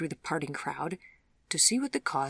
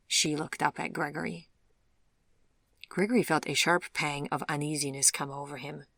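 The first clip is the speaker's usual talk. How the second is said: plain reading voice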